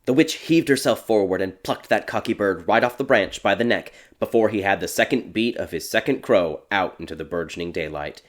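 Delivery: usual speaking voice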